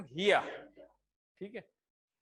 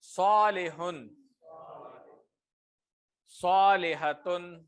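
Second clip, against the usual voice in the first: slow drill words; much slower than usual. Shown as a demonstration. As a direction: model every word slowly for students to echo. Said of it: "slow model pairs for repetition". (طلبہ کے دہرانے کے لیے آہستہ بلند تلفظ)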